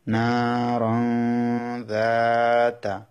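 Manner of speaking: নারন যাতা drawn out very slowly in recitation; Quranic recitation. Shown as a demonstration. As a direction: chant নারন যাতা slowly, joining the nunation. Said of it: "যেমন".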